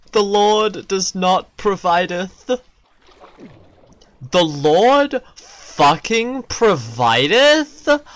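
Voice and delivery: milk it like a preacher seeking an amen; mock preacher. Player preaching that the Lord provideth, mock sermon.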